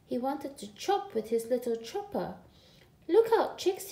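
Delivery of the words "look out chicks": high, loud warning shout. A narrator's reading. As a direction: call it out to scare the chicks. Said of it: "he shouted".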